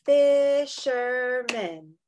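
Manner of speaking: very slow, beat by beat; "Fisherman" slowly, one beat per syllable; clapped syllables. Shown as a demonstration. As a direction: stretch "Fisherman" across three claps. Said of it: "Let's clap that one more time".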